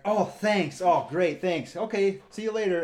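imitating a hasty, relieved thanks; breezy thanks. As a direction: toss off thanks as you leave. Dog owner gladly escaping the mess.

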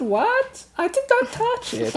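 high, squeaky denial; indignant protest. Retold or imitated. [name] retelling his donut accusation, squeaky high protest. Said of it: "I said".

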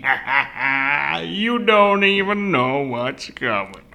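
slow, hammed-up villain's gloat; evil, malicious grandpa. Grandpa hiding the lamb's fate, slow malicious mock gloating. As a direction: cackle it slowly, like a mock villain. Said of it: "evil, like, malicious".

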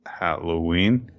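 a slow, puzzled repeat of "Halloween?" with a rising end; baffled, halting "Halloween?"; confused. Acted. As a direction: echo "Halloween?" slowly, not sure what you heard. Baffled driver repeats the word.

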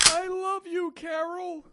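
high dying words. Acted. Dying farewell.

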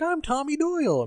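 high, self-important "I'm Tommy Doyle"; mock Tommy. Tommy introducing himself to Loomis in a high mock voice. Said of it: "he's like".

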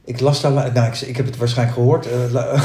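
usual speaking voice

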